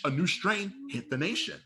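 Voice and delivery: usual speaking voice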